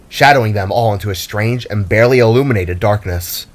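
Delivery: ordinary delivery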